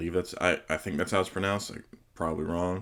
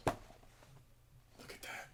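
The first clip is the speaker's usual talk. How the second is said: slow, hushed whisper of admiration; barely audible, drawn-out marvelling whisper; awed whisper; 20 dB quieter, much slower than usual. Real, not acted. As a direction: whisper it slowly, in real awe. Awed at a beautiful art piece.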